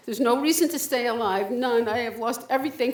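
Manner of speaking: usual speaking voice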